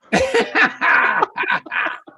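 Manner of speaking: high breathless laugh; real laugh. Real, not acted. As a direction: laugh airily through the emotion. Laughing while tearing up.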